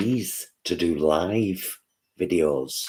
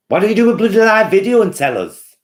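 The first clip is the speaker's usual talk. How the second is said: much higher, louder teasing demand; playful demand; 14 semitones higher, 9 dB louder than usual. Real, not acted. Vlogger cheekily demanding a viewer go live. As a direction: push them cheekily to go live.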